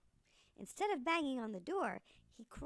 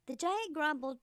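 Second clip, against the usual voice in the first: usual speaking voice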